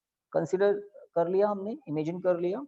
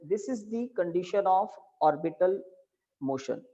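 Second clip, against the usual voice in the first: dictating; 4 dB quieter, much slower than usual. Shown as a demonstration. Dictating the note.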